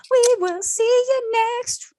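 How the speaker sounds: high, slow, drawn-out show sign-off; host signing off the episode, slowly and drawn out. Acted. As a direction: stretch the goodbye out like a closing line.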